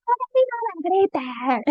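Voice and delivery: high-pitched exasperated outburst; shrill-pitched mock scolding; mock parental yell. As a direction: yell it as a fed-up parent, hammed up. Mock yelling parent demonstration.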